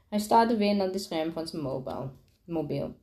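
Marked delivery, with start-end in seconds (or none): none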